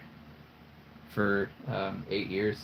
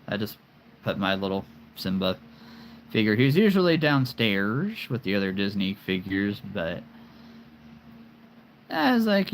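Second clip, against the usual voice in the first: ordinary delivery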